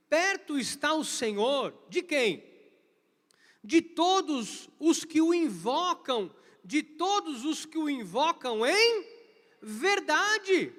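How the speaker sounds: loud, slow preaching voice. Acted. Pastor proclaiming Psalm 145 to the congregation, loud and slow.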